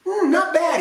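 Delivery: pleased surprise. A narrator's reading. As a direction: prince after the kiss, high pleased surprise. Prince smiles after kiss.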